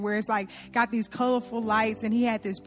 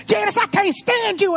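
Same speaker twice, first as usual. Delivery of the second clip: put-on high, loud yell at his girlfriend; angry boyfriend; 9 semitones higher, 5 dB louder than usual. Boyfriend telling Janice off, loud high yell. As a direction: spit it out like a fed-up boyfriend. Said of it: "He said".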